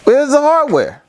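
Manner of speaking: fed up, where is it; exasperated. Real, not acted.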